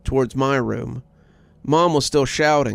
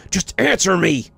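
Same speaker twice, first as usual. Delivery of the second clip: raised voice; 6 semitones higher than usual. A narrator's reading. Father demanding his wife answer him, voice raised. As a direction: bark the demand at her. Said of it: "His voice raised again".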